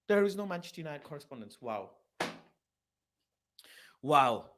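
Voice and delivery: normal talking voice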